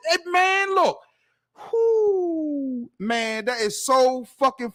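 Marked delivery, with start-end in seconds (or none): none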